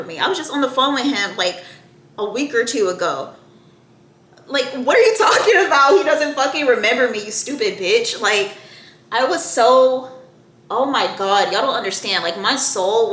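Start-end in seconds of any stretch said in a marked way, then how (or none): 4.7-8.2 raised, high incredulous outburst; indignant telling-off of an absent texter; outraged retort. Retold or imitated. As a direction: snap back loud and high, then drop on the insult. Outraged reply to texted claim.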